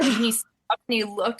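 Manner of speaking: ordinary talk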